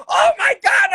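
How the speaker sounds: mom's high, loud shriek; piercingly high panicked yell; screaming. Retold or imitated. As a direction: scream it like a horrified mother. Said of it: "she starts screaming".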